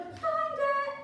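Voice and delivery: very high, loud squeal of delight; giddy farmer. Pretend. Skipping farmer to the valley, a squealing giddy cry. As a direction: skip and squeal like a giddy fool. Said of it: "a farmer skipping down the mountain road going".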